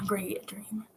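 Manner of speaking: hushed whisper to himself; whispered aside. Real, not acted. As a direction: breathe it out under the breath. Reader's aside after chapter.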